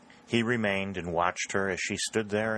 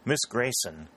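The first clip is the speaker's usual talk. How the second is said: ordinary delivery, nothing marked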